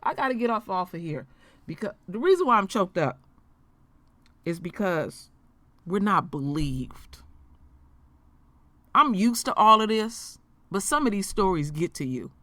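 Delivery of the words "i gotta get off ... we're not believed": choked up. Real, not acted. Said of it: "It's got me choked up".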